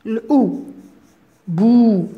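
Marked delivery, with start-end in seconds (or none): 1.5-2.1 بو said alone, louder; teacher isolates بو to show the ou sound; isolated syllable. Shown as a demonstration. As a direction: pop out بو on its own. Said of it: "فيها صوت الأو".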